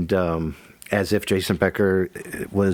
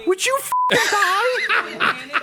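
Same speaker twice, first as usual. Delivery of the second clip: very high slow laughing plea; laughing in exasperation; laughing exasperated; much slower than usual. Real, not acted.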